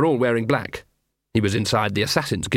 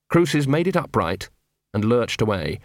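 plain reading voice

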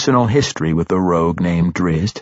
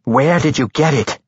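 higher-pitched, irritated question; angry demand; 6 semitones higher than usual. A narrator's reading. Matron mother turning back on Tosin, angrily. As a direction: demand an answer with mild anger. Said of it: "turned back on Tosin angrily".

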